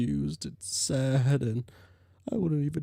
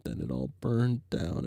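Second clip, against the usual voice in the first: usual speaking voice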